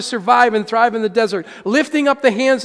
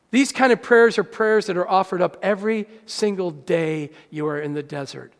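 normal talking voice

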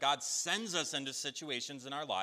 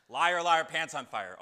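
loud, high schoolyard taunt; loud childish jeer, falling off at the end; playful taunt; 4 semitones higher, 11 dB louder than usual. Acted. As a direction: burst out with a teasing accusation. Jokingly escalates the harsh accusation.

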